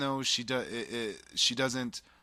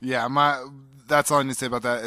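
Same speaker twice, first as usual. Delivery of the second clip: much louder, cutting the teasing short; loud and a bit higher, shutting down the jab; loud defensive; 4 semitones higher, 11 dB louder than usual. Real, not acted. Defensive after co-host mocks poster.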